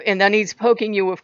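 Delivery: usual speaking voice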